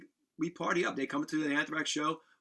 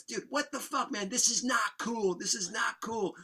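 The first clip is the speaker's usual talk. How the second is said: mock pissed off; 8 semitones higher, slower than usual. Woken friend telling off the noisy band, high mock anger. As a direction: imitate an angry friend's complaint. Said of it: "he's, he's fucking pissed".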